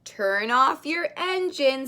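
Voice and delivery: plain reading voice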